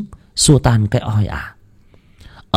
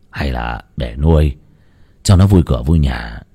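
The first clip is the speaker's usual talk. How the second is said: put-on placid old husband; 9 semitones lower than usual. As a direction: shrug it off, deep and easy. Ông chồng muốn nuôi chó. (giọng ông lão trầm, nhẹ nhàng trấn an)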